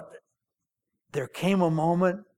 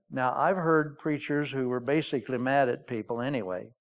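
ordinary talk

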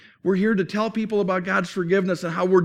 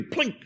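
high little plink sound; a light, playful plink noise; slingshot plink; 8 semitones higher, 5 dB quieter than usual. Retold or imitated. Boy firing slingshot at a tree, high plink.

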